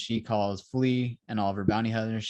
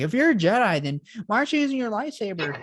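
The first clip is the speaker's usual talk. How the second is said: put-on much higher, quick questioning kid voice; mock pestering child; 12 semitones higher, 4 dB louder, faster than usual. Young Leia challenges the supposed Jedi, mock pestering. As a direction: imitate a kid needling a grown-up.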